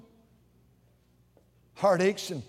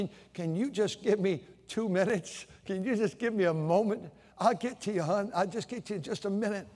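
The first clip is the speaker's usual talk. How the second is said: softer, kind and patient; gentle reply; 7 dB quieter than usual. Retold or imitated. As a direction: reassure the child softly. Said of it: "You might say".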